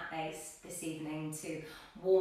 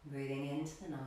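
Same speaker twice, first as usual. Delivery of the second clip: calm yoga teacher cueing breaths, long pauses; guided meditation; 2 semitones lower, 4 dB quieter than usual. Real, not acted. Yoga teacher settling class into a seated position, guided meditation. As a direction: settle them gently into stillness, phrase by phrase.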